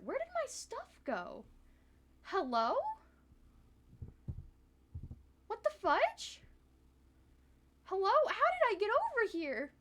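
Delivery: high-pitched, startled confusion; baffled. Real, not acted. Streamer lost in Blender, baffled and high-pitched. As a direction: squeal in disbelief at the software. Disoriented when her scene jumps away.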